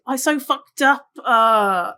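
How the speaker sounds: "up" followed by a tacked-on "uh"; whiny "up, uh" sentence ending; added uh ending. Shown as a demonstration. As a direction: finish on "up", then drag out "uh". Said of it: "and I just did that".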